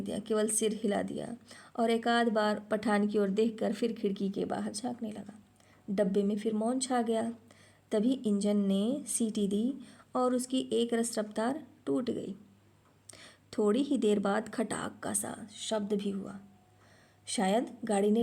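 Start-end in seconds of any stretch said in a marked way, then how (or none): none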